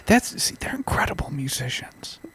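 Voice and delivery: hushed breathy whisper. Pretend. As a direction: whisper it like a secret. Mock hushed praise.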